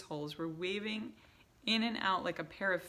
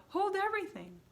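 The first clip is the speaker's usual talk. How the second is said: high, loud shriek; 6 dB louder than usual. A narrator's reading. Witch in the back row halting the crowd with a shriek. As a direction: shriek to freeze everyone. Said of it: "Her shrieking voice echoed".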